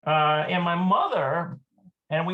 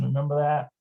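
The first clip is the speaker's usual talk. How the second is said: usual speaking voice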